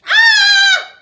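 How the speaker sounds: shrill, loud shriek of fright; scream. Acted. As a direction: scream as slime touches your feet. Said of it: "She screamed".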